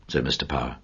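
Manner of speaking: ordinary delivery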